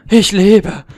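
lauter, erleichterter Jubelruf; jubel, dass du überlebt hast; excited. Real, not acted. Überlebt knapp nach Schlaf. (erleichterter Freudenschrei)

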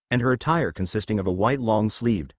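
ordinary delivery

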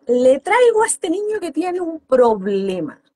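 overdone mock shout of a complaining mother. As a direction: play the exasperated mother yelling. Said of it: "Gritando, exagerando la voz".

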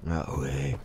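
low, gruff American action hero; a hint of a gravel-voiced game hero; deep video game hero voice. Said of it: "une voix de héros de jeu vidéo américain".

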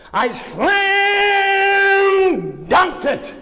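high, slow, exultant cry; triumphant shout. A narrator's reading. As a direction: shout out the big moment. Triumphant climax of story.

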